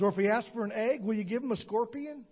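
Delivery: ordinary talk, nothing marked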